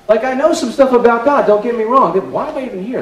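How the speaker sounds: loud, high insistence; emphatic protest. Real, not acted. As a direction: protest that he does know something. Defending himself, rising excitement.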